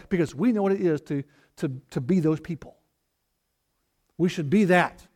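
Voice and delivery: ordinary talk, nothing marked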